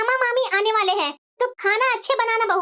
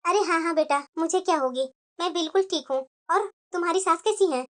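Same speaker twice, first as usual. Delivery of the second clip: put-on much lower and softer, a gentle older mother; 7 semitones lower, 4 dB quieter than usual. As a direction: reassure kindly, unhurried. भाभी की माँ का किरदार. (बेटी को तसल्ली देती माँ, धीमी आवाज़ में)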